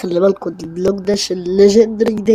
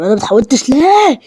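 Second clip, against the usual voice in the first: high, loud indignant complaint; indignant outburst; 11 semitones higher, 4 dB louder than usual. Real, not acted. Gamer complaining the game skipped him, indignant outburst. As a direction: protest the unfairness. Outraged he didn't transform.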